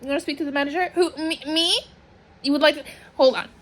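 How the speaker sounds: high-pitched, playful manager skit; playful manager act. Acted. Answering a request for the manager, playful act. Plays herself as manager taking a call.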